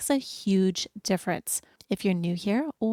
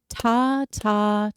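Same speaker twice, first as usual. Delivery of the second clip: counting aloud. Shown as a demonstration. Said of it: "we're gonna say ta for each one".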